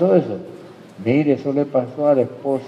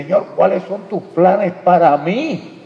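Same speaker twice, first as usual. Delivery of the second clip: higher, slow, drawn-out question to the Lord; modeled plea to God; 7 semitones higher, 3 dB louder, slower than usual. Acted. Preacher modeling how to ask the Lord for his plans, heightened plea. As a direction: raise the voice and stretch out the question.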